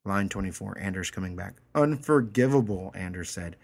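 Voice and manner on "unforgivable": louder, higher mock gravity; mock solemn. Anders mocking the woman, mock solemn. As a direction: pronounce it like a judge, in jest.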